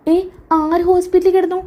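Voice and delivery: high-pitched innocent question; feigned surprise. Acted. Daughter-in-law dodging blame, feigning surprise. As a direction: play dumb, voice pitched right up.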